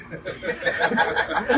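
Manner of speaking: breathy burst of laughter at his own tale; real unvoiced laugh. Real, not acted. Laughing at his own tale, a real laugh. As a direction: laugh off the tall story.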